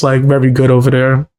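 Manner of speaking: usual speaking voice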